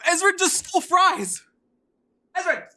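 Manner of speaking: high loud indignant yelling; scandalised high shouts at Ezra; shocked outcry. Real, not acted. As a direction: yell at the thieving cat. Cat steals fries.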